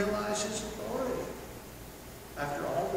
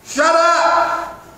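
much louder, higher sharp commands; commanding shout; 10 semitones higher, 15 dB louder, much slower than usual. Retold or imitated. Jesus rebuking the wind and waves, loud command. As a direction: order the storm to stop. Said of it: "and he said".

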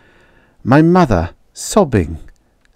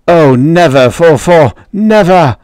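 high, loud, desperate denial; a child insisting it can't happen; loud cry of denial; 9 semitones higher, 8 dB louder than usual. Acted. Boy crying to his robot, loud and high.

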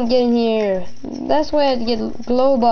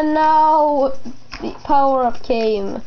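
normal talking voice

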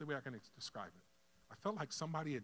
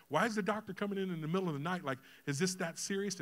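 higher, louder, rushed questioning; alarmed questioning; 5 semitones higher, 5 dB louder than usual. Retold or imitated. Father asking why the doctor comes at night, alarmed. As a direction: press the question in rising alarm.

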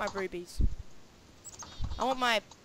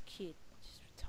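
whisper; 7 semitones lower, 23 dB quieter than usual. Real, not acted. Muttering aside.

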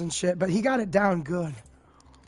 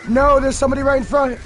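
panicked; 5 semitones higher, 5 dB louder, faster than usual. Real, not acted. Enemy appears in front.

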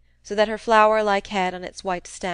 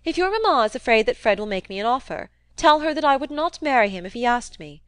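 put-on louder, heated young woman; indignant Mary; 4 semitones higher, 5 dB louder than usual. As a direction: declare it with raised, indignant voice. Narrator voices indignant Mary.

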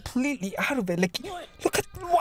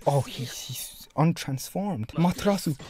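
ordinary delivery

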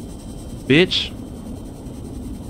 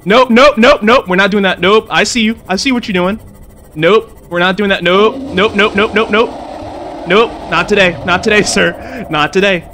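higher, louder rapid nopes, dragging at the end; backing away in a panic; panicked refusal; 7 semitones higher, 4 dB louder than usual. Real, not acted. Gamer backing away from creature, panicked nopes.

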